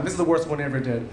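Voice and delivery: ordinary delivery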